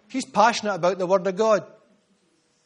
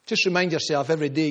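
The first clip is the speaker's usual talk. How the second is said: ordinary talk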